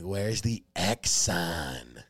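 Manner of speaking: very slow, labored mock-drunk speech; imitating drunken slurring. Drunk fan asking Domino's manager for directions, imitated slurring. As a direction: drag the words out as if barely able to talk. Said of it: "I can, like, barely talk. I'm so fucked up".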